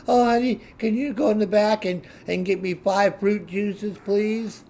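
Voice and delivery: high, sugary mock voice; mocking sweet boyfriend. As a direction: ham up a syrupy boyfriend asking a favour. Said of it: "your little boyfriend's like".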